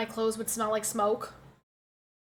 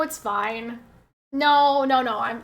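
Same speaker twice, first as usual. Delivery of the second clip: normal talking voice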